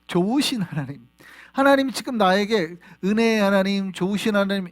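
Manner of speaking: normal talking voice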